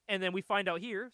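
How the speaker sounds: ordinary delivery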